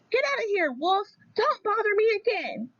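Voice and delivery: high, squeaky and rude little pig. Acted. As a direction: shoo the wolf away in a tiny high voice. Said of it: "rude little porker answered".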